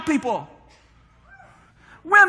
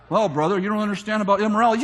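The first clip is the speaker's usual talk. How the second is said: much lower, fast mocking voice; lowered, rushed imitation of a critic; mock objector; 6 semitones lower, much faster than usual. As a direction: lampoon a pious critic. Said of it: "objector quoted".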